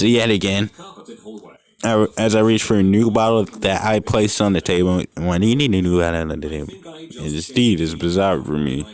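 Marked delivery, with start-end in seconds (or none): none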